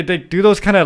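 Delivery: ordinary talk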